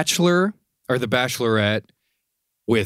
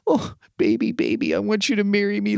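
high, whiny imitation pleading; mockingly pitiful high plea; mock crying; 11 semitones higher than usual. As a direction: mimic a weepy boyfriend begging. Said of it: "crying, and he's, like, hitting things".

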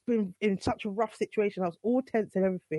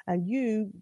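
ordinary talk

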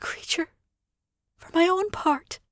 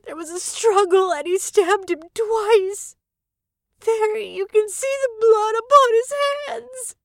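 slow, higher, half-sobbing account; tearful testimony; 6 semitones higher, slower than usual. A narrator's reading. Lady tells the constable of the murder, slow and tearful. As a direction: tell it slowly, voice catching with tears.

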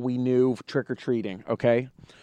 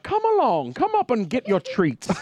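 put-on old gentleman voice; 7 semitones higher than usual. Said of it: "And then he's like".

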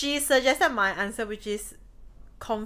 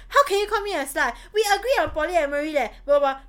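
mock angry; 5 semitones higher, 4 dB louder, faster than usual. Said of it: "if I were angry".

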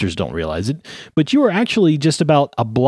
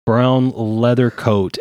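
ordinary delivery

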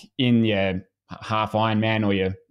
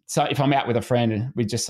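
normal talking voice

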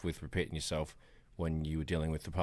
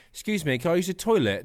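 higher and louder, a prissy proper ask; prim polite request; 10 semitones higher, 6 dB louder than usual. Retold or imitated. Dan asks the Texans for the toilet, prim and higher. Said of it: "if Dan walks in and goes".

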